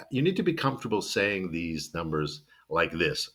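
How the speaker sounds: usual speaking voice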